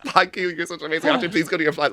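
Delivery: high, loud retold gushing; gushing fan. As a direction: imitate yourself fawning over a star at full volume.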